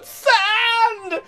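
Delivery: high shouted outrage; outraged cry. Acted. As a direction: yelp it in mock horror. Outraged outburst about sand.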